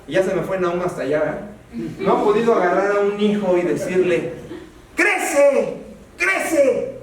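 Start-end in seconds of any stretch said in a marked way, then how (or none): 5.0-7.0 orden burlona, que sube. Pretend. Orden imposible a un hijo.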